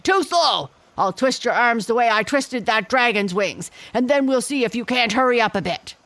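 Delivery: loud, high, fast furious gorilla; bully him with a snarling threat; angry gorilla threat. A narrator's reading.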